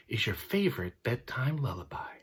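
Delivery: whisper. Real, not acted. Wishing kids good night.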